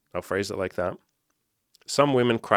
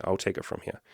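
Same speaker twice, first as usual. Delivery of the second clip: whisper; 8 dB quieter than usual. Acted. Talking back to his own ego.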